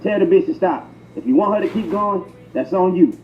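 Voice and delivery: normal talking voice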